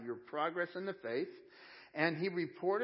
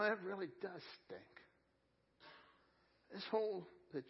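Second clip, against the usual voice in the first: softer, halting, with long pauses; quietly commiserate with a hurting friend; gentle sympathy; 6 dB quieter than usual. Acted. Acted comforting of a hurting friend.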